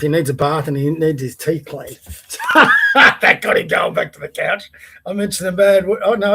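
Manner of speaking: usual speaking voice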